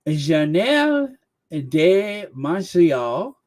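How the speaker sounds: slow, put-on French accent on Journal de Montréal. Host retrying the paper's name in a French accent. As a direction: roll it out like a proud Quebecois. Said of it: "do this French accent".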